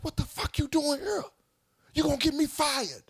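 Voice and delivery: high, shrieking mock girlfriend; imitating an angry girlfriend. Girlfriend mad at him.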